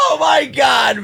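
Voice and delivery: high exclamation after a laughing fit; amused disbelief. Real, not acted. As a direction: cry out, tickled by the absurdity.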